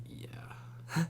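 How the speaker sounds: whispered yeah. Retold or imitated. Retelling own reply on a trip.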